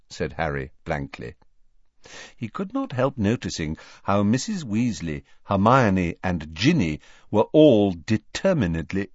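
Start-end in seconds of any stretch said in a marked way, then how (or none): none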